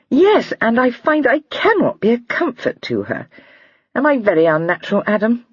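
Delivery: put-on high, light girlish voice, amused; a lively young girl laughing at a joke; young lady Lydia. A narrator's reading. Lydia's voice read aloud.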